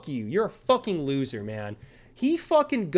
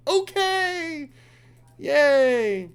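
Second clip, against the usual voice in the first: mock cheer; 10 semitones higher, 3 dB louder, much slower than usual. Sarcastic reply to the cop.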